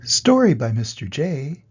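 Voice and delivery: ordinary delivery, nothing marked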